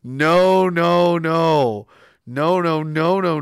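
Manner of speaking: loud, insistent refusal; loud repeated no. Real, not acted. Refusing chat's gross message, loud repeated no. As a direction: keep hammering no at chat.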